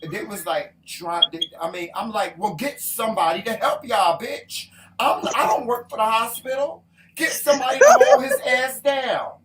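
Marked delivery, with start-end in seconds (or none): 2.4-9.3 loud, higher-pitched outraged demand, building in volume; indignant retort. Retold or imitated. Wife retelling her demand to hospital staff for help, indignant. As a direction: reenact telling off the staff, loudly.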